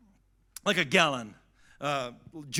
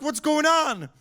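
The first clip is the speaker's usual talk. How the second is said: high-pitched imitation of a worried mother; alarmed mom; 7 semitones higher, 3 dB louder than usual. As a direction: play a mom demanding an explanation. Mother reacting to angry call.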